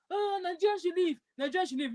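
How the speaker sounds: mock yell without the volume; mock shouting.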